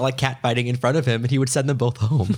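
normal talking voice

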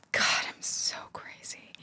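soft unvoiced aside; whisper. Real, not acted. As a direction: whisper it to yourself. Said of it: "whispered aside".

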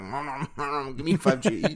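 lightly voiced greedy munching; mock chomping as a Wi-Fi eater; a hint of a munching gremlin; munching cockney. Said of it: "they're like".

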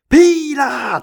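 high, loud yell of protest; shouted heckle. Retold or imitated. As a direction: belt it out like an angry fan. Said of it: "旁边的球迷直接大喊".

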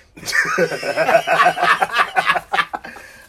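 loud, long burst of laughter; real uproarious laugh; big laugh. Real, not acted. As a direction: crack up at your own story. Laughing at own story.

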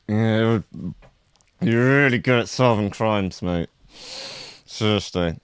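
words through clenched teeth; gritted teeth. Shown as a demonstration. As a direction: teeth together, jaw locked. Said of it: "they keep the teeth together".

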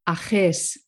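"Ajes" with the consonant scraped in the back of the throat; throaty Spanish G. Shown as a demonstration. Native speaker modelling a tricky town, "Ajes" with a throaty kh. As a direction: say "Ajes" as a-KHES, throaty middle sound. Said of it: "sort of in the back of the throat".

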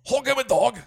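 loud, high, exaggerated German accent; shouted mock-German pronunciation. Shown as a demonstration. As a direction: bark it out in a hammed-up German accent. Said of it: "it sounds German".

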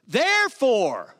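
"Therefore" dicho lento y marcado, como palabra clave; slow, weighty "Therefore" standing alone; stress on Therefore. Shown as a demonstration. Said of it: "you need to emphasize the first word".

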